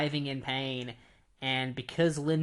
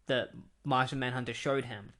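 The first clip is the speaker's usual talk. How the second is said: usual speaking voice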